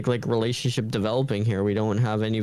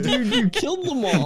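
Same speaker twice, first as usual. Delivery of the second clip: high-pitched laughing mock shock; amused, high imitation of shock; laughing mock disbelief; 9 semitones higher than usual. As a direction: squeal it through laughter. Laughing mock reaction to the character.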